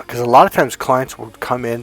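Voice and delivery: ordinary delivery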